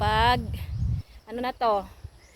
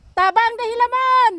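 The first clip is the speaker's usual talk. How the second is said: high, loud long-distance call; shouting; 12 semitones higher, 7 dB louder than usual. Real, not acted. Calling a cousin to come help weed, shouting. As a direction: holler for help across the field. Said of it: "sinigawan ko".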